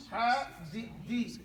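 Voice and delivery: Hadith in separated, drawn-out syllables; teacher sounding out Hadith slowly; syllable-by-syllable model. Shown as a demonstration. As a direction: say Hadith piece by piece, HA then DEETH.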